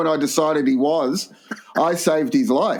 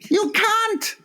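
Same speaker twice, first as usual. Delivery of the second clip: high-pitched mock-angry Chinese accent; Ray's Chinese accent. Near-drowned mate berating his rescuer, hammed-up Chinese accent. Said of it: "you're doing the accent".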